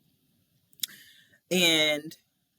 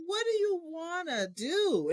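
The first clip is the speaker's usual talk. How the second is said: warm mayor; 12 semitones higher, 3 dB quieter, slower than usual. Retold or imitated. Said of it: "she's like".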